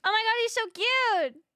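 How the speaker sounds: high, loud delighted squeal; excited. Real, not acted. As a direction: gush over something adorable. Gushing over a cute character.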